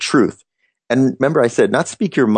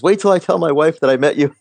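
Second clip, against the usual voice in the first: high, bursting to tell his wife; thrilled fan; 6 semitones higher than usual. Retold or imitated. Fan planning to tell his wife, high thrilled gush. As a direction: gush like you can't wait to brag. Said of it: "he says".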